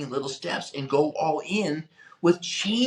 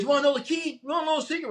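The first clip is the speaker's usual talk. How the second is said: teasing hype; 10 semitones higher, much faster than usual. Retold or imitated. Seminar speaker teasing the crowd before the reveal, high and eager. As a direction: dangle the secret in front of them.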